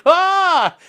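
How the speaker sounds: high, sudden imitated yelp; a quick hammed-up shriek; mock startled yelp. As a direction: yelp like the startled British guy. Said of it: "that British guy with the train thing where he's like".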